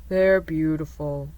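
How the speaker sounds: low, wistful donkey voice; Eeyore, wistful. A narrator's reading. Donkey answering about the thistles, low and wistful. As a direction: drop it low and gloomy-wistful. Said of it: "Eeyore answered wistfully".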